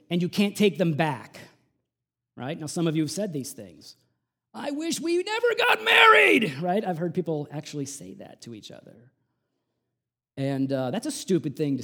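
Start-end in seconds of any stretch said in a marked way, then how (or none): 4.5-6.5 much higher, louder furious outburst at a spouse; angry shout. Acted. Spouse yelling at the other in a marriage fight, angry shout. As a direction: hurl it at your spouse in a fight.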